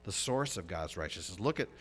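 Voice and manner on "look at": ordinary talk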